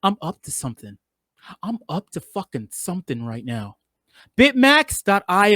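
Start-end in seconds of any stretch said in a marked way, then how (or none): 0.0-3.8 sly low aside. Pretend. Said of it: "You see how I'm leaning".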